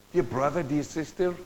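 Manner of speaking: ordinary talk, nothing marked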